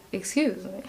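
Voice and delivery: monotone voice tipping a bit high; Gustavo Fring. Retold or imitated. A fan imitating a Breaking Bad character, mono then high. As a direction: imitate a cool monotone that lifts briefly. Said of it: "mono and then it gets a bit high".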